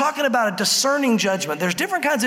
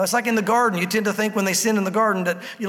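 ordinary delivery

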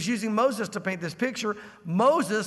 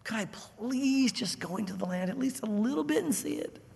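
pleading Moses; 3 semitones higher, 4 dB quieter than usual. Acted.

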